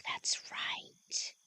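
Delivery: unvoiced, teasing whisper; mocking whisper. Woman teasing the vampire about his principles, mocking whisper. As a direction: tease him in a hushed voice. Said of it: "Don't mock me".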